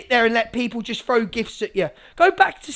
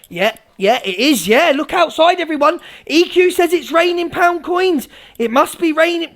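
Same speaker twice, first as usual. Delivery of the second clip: gullible followers eagerly agreeing; Evil Army impression; 3 semitones higher than usual. Mimicking followers who believe anything EQ says, mock-eager. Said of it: "I'll do an impression of the Evil Army".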